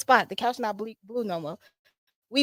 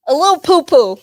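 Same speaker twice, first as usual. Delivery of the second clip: loud playful reveal; 6 semitones higher, 12 dB louder than usual. Acted. Silly self-mocking reveal.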